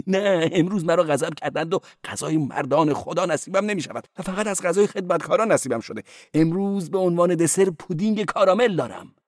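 high-voiced cleric. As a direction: play the cleric high and quick.

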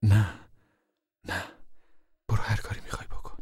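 softly, trailing into a fast whisper; quietly resigned, letting him go; mother quietly gives in to the uncle. A narrator's reading. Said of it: "اما آرام گفت".

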